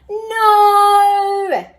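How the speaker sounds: high, loud crying howl. A narrator's reading. Bob the dog howls at the eaten cake. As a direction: howl it long in dismay. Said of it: "howled Bob".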